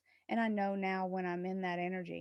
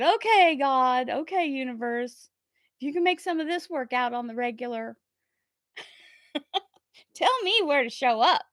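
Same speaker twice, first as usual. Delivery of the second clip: high, loud and eager, laughing near the end; excited call to the universe; 4 semitones higher, 10 dB louder than usual. Retold or imitated. She shouts up to God and the universe, loud and excited. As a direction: fling the request up to the heavens.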